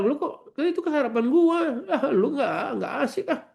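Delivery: put-on high, whiny child protesting; mock indignant kid. A kid whining when his breakfast is eaten. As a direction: squeal like a kid caught out. Ustaz acts out quarrelling kid.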